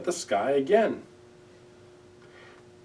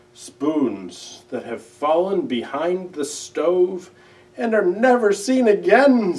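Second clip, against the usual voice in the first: tearful Owl; 5 semitones higher, 7 dB louder than usual. A narrator's reading. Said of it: "More tears dropped down".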